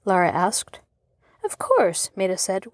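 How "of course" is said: much higher, puzzled agreement; wondering. A narrator's reading. Maida answering Laura, higher and wondering. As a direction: say yes while wondering why.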